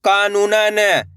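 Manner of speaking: word stretched very slowly; drawn-out reading. Shown as a demonstration. Teacher reading a word for learners, drawn out. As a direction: read it out with long held vowels. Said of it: "तो मिलके बन जाएगा".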